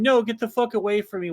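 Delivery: retold scream. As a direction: imitate an upset girl screaming, without full volume. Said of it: "and she screamed".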